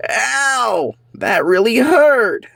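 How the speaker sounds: high, slow pained wail; cartoon pain yelp. King cat tripped, high pained wail. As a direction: yelp then whine.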